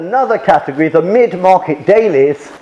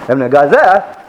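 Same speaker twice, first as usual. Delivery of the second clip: slurred street cry of "Evening Gazette"; newspaper seller's cry; 2 semitones lower than usual. Retold or imitated. Blackpool newspaper seller calling to passers-by, imitated street cry. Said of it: "they have these special cries".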